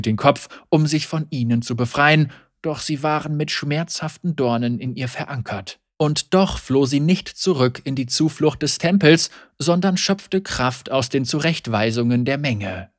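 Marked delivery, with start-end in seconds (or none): none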